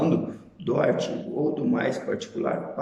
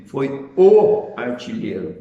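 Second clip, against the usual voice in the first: o artilheiro said slow and louder, leaning on o; emphatic article o, drawn out; stress on the article; 6 dB louder, slower than usual. Shown as a demonstration. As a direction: hit the o in o artilheiro, slow and loud. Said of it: "com a entonação do artigo o".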